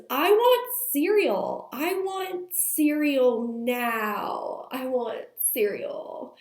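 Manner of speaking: slow, drawn-out mock toddler demand; imitated demanding toddler. Two-year-old demanding breakfast from mom, imitated insistence. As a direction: start high and loud, stretch each word like an insistent child. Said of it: "she says".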